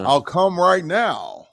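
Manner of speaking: high-pitched lowballing buyer; pushy buyer voice. Retold or imitated. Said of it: "I hate Marketplace".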